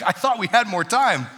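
mock dismay. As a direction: play up a dad's dismay for laughs. Comic retold reaction to daughter's outburst.

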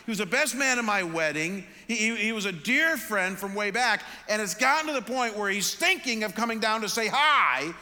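frustrated rant. Retold or imitated. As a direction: vent it all, fed up with the situation. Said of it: "'Cause I sat there and said".

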